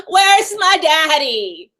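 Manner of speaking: slow, laughing whine of where's my daddy; laughing through a mocking where's my daddy; mock child, laughing. Voicing baby while laughing.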